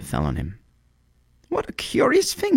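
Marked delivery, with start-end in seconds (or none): 1.5-2.6 much higher squeak of surprise; a bird startled by a raindrop; surprised cry. A narrator's reading. Swallow startled by a raindrop, much higher surprised cry. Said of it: "he cried".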